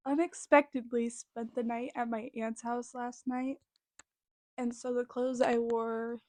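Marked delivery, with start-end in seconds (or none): none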